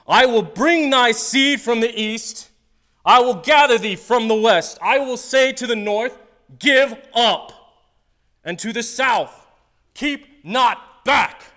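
loud, slow, high booming scripture reading; preaching scripture. Acted. Pastor booming God's promise to the congregation. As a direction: proclaim it to the whole room.

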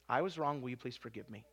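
low, soft plea for forgiveness; humble apology. Acted. Preacher modelling an admission of wrong, humble quiet apology. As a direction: sink to a hushed, contrite ask.

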